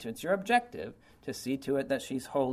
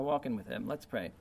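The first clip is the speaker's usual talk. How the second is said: usual speaking voice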